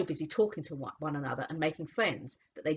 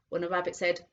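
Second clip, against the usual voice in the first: ordinary delivery, nothing marked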